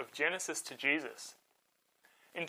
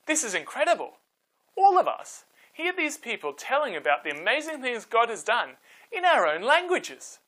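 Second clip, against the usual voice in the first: higher, louder, wide-eyed astonishment; amazed crowd; 8 semitones higher, 5 dB louder than usual. Acted. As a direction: sound like you can't believe your ears. Amazed festival crowd voiced.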